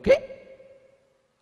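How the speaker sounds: high, breathless gasped "¿Qué?"; shocked what. Retold or imitated. Father stunned by his daughter's news, gasping in disbelief. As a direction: blurt "¿Qué?" in pure disbelief.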